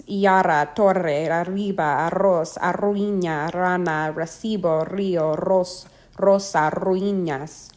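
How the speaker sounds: torre, arriba, arroz with RR trilled by repeated tongue taps. Shown as a demonstration. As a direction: roll the R at the start of rana, río, rosa. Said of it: "double R has a trilled sound".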